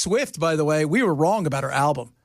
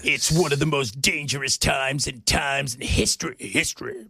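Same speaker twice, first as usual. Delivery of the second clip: dramatic attack-ad voice; 4 semitones lower than usual. Retold or imitated. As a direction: read it like a scary campaign ad. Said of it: "And they talk like this".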